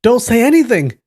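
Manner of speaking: high, loud shout; shouted warning. A narrator's reading. Guo Jing tries to silence the captive girl, shouting. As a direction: shout to silence her urgently. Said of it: "Guo Jing shouted".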